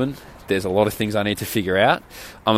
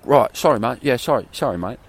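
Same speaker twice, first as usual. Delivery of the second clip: put-on English accent; 3 semitones higher than usual. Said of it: "these English guys come home".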